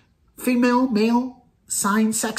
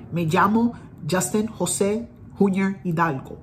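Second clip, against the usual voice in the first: Spanish pronunciation. Shown as a demonstration. Telling viewers his full name in Spanish, Spanish accent. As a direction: say it the Spanish way, llamo and Jose Spanish-style. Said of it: "In Spanish".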